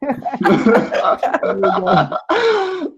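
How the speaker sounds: said through a laugh. Real, not acted. Laughs at own ruling.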